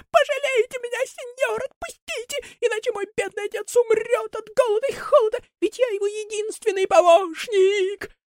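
very high, crying plea; piercing plea. Acted. Buratino begging Karabas to let him go. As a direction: beg him shrilly, near tears. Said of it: "пронзительно кричит".

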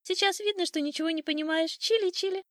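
high teasing bird chatter; mocking old sparrow. A narrator's reading. Sparrow making fun of the rooster, high mocking twitter. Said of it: "смеялся старый воробей".

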